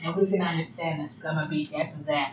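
low laughing mutter; quiet laughing. Real, not acted. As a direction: laugh it out under your breath. Joking threat, laughing.

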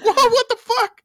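hammed-up shocked squeak; shocked dad. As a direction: jump up in pitch like a stunned father. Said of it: "And the dad's like".

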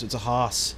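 "hoss" drawled as haas, r dropped from horse. Real, not acted. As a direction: say "hoss" with a broad ah vowel. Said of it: "when I say it like that".

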